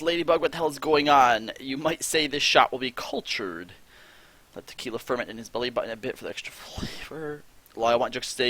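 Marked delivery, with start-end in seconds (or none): 4.5-7.4 whispered chat line. Real, not acted. Reading gross chat aloud, whispered. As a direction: read it under your breath.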